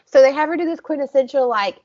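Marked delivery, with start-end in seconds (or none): none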